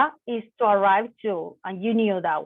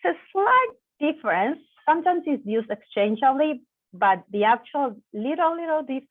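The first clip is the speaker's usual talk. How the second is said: normal talking voice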